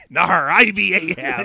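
hammed-up pirate "I be Ahab"; pirate Ahab introducing himself. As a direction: play a mock old sea dog introducing himself. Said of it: "old-timey pirate voice".

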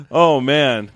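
ordinary talk, nothing marked

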